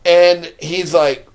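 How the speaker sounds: ordinary talk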